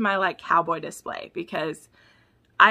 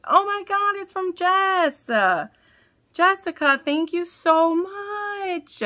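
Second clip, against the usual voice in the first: higher, slow and louder thanks; gushing thanks; 11 semitones higher, 3 dB louder, slower than usual. Real, not acted. Fan thanking Jessica for a gifted book, gushing. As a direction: overflow with gratitude. Thanking gift sender excitedly.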